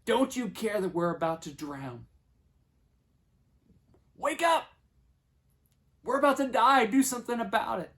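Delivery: building to a high, loud panicked yell; acted-out terrified urgency; frantic. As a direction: shout as if waking someone in mortal danger. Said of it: "wake him up frantically and say".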